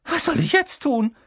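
very high, shrill panicked question. A narrator's reading. Hermine, locked out, asks the boys, shrilly. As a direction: pitch it right up, shrill. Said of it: "fragte sie mit schriller Stimme".

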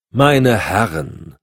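slow, drawn-out admonishment; stretched, authoritative reprimand; calling to order. A narrator's reading. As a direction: halt the squabble with two weighty words. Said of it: "unterband der Präsident die drohende Diskussion".

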